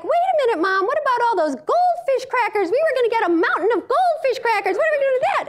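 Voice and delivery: put-on children protesting in a high whine; whiny kids. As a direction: play the kids whining about the goldfish crackers. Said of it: "your children are going like".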